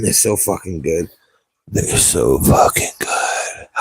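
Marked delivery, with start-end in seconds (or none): 1.7-3.7 hushed, slow, lower whisper; whispered praise for cheap mics. Shown as a demonstration. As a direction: breathe it like a secret.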